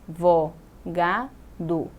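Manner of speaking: "Vó, ga, do" with a pause after each; each of "Vó, ga, do" as a separate beat; split into syllables. Shown as a demonstration. Teacher dividing advogado, "Vó, ga, do" syllable by syllable. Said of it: "São quatro sílabas".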